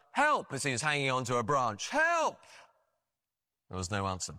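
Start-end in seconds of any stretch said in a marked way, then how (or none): 0.1-0.5 high-pitched cry up for help; calling for help. Retold or imitated. Man hanging from a cliff branch, calling up for help. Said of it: "he called up". 1.9-2.4 drawn-out high call; retold cry for help, pitched high. Man hanging onto a branch, calling up again for help. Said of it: "he called up".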